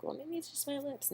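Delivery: lightly voiced hopeful self-doubt; a wistful high aside on its; mock thought. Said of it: "I was like".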